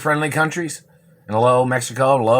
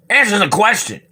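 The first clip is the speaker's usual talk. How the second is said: higher, sharp command; angry demand; 8 semitones higher than usual. Real, not acted. Demanding the government people answer him, an angry command. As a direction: bark the order.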